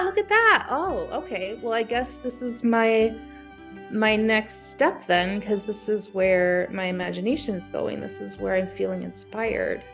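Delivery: usual speaking voice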